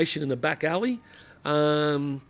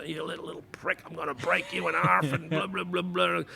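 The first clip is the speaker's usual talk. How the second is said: put-on Glaswegian accent; 7 dB quieter than usual. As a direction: threaten him in a thick Glaswegian accent. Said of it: "in his broad Glaswegian accent".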